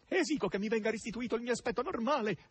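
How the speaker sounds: high, begging hog; half despairing, half imploring; desperate pleading grunt. A narrator's reading. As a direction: demand it in a high, desperate whine. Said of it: "rantolò e grugnì ... fra il disperato e il supplichevole".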